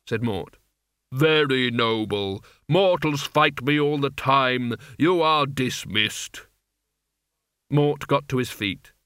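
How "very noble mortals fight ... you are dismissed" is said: slow, cold, lordly dismissal; Death's voice. Acted. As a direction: dismiss him with icy calm. Death dismisses Mort coldly.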